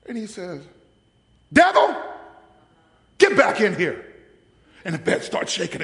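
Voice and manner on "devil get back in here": sudden high, loud yell at the devil; commanding the devil back, full voice; shouted command. Retold or imitated. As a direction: bark the order at the devil. Preacher orders devil back.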